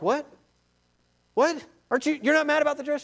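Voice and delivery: high, rushed, incredulous question; stunned disbelief. Acted. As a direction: blurt it out in acted astonishment. Kids stunned mom isn't angry.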